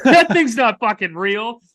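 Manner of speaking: high, loud, slow, laughing through it; laughing disbelief. Retold or imitated. Guest laughing at the plastic turtle, loud laughing disbelief. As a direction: laugh out the outrage.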